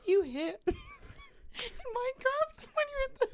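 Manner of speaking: high, slow words through laughter; laughing. Real, not acted. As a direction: talk while cracking up. Laughing at the Gollum attempt.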